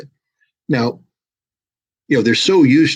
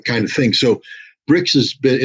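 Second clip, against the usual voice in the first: ordinary talk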